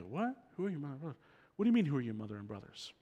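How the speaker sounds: puzzled, hushed muttering, quick at the end; confused crowd mumble. Retold or imitated. Crowd muttering back to Jesus, quiet and puzzled. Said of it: "We go".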